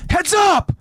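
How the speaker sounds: quick, high-pitched shout of warning; warning yell. Acted. Connie warning friends of a Titan, high yell. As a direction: yell out to warn them, voice high. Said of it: "Connie yells out to warn".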